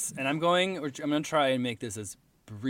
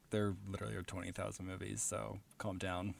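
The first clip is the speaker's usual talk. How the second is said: low, very soft deadpan self-quote; muted retort; 7 semitones lower, 16 dB quieter than usual. Retold or imitated. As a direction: drop right down for a dismissive reply. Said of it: "my response is always like".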